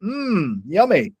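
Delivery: high, swooping show of enjoyment; performed delight. Retold or imitated. Customer nailing the reaction for store guy, performed delight. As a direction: overdo the pleasure to please him. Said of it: "I've gotta nail this reaction".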